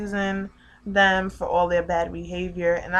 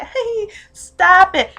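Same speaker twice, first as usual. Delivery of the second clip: put-on high, drawn-out little-girl whine; mock a little girl's playful protest; five-year-old girl; 12 semitones higher, 11 dB louder, much slower than usual. Mocking how a five-year-old girl would object, childish. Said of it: "five-year-old girl that should be like".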